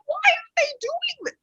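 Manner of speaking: high mock-puzzled voice; exaggerated high-pitched bewilderment; feigned bafflement. Mimics feigned puzzlement at racism.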